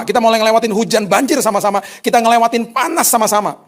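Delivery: loud, high, impassioned preaching; full-force preaching. Acted. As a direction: drive it home to the congregation. Preacher driving point home.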